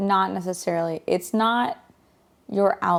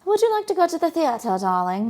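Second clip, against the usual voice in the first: high, quick society lady voice; posh lady voice; 8 semitones higher than usual. Pretend. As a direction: ask it like a fancy lady. Playing posh aristocrat couple.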